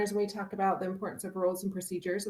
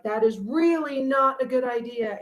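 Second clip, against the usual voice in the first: chicks warning louder; 4 semitones higher, 6 dB louder than usual. Retold or imitated. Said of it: "they're like".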